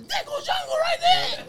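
a mock shout, cracking up at the memory; laughing high shout. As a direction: squeal it way up high through a laugh. Retelling Stout almost exposing him.

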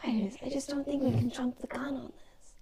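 hushed plea to the group; whispering. Real, not acted. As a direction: whisper it softly to your companions. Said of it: "you're sort of whispering all this".

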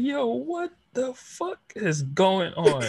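high, slow incredulous yo; mock disbelief, drawn out; confused outrage at a meme; baffled. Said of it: "And I was like".

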